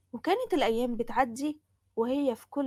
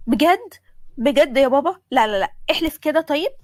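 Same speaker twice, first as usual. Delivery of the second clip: thrilled disbelief; 3 semitones higher, 10 dB louder than usual. Acted. Daughter hearing father's surprise about design lessons, thrilled and disbelieving. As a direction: burst out, overjoyed and incredulous.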